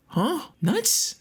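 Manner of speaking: high rising squeaky question; incredulous high-pitched query; baffled disbelief. Acted. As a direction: shoot up high, utterly baffled. Baffled by the word read out.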